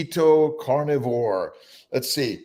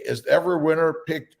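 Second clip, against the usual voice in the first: ordinary talk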